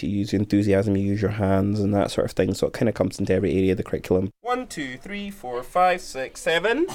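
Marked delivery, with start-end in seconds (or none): 4.4-6.9 high sing-song classroom count; raised-pitch teacher counting aloud; counting with class. Real, not acted.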